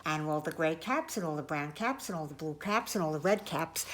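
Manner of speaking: hurried low storyteller's list; quick list. A narrator's reading. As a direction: rattle off the list to build up. Listing caps before they fly.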